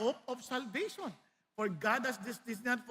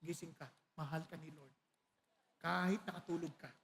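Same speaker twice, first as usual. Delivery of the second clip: gentle reminder; 10 semitones lower, 9 dB quieter than usual. Shown as a demonstration. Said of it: "Pakisabi sa katabi mo".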